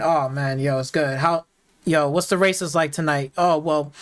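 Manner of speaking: low, easygoing car-meet small talk; normal racer chat. Acted. Acting out how street racers really talk, casual and low-key. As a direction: play both sides of a casual, low-pitched chat. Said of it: "Everybody talking like".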